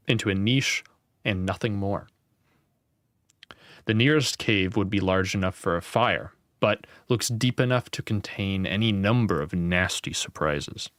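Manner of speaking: ordinary delivery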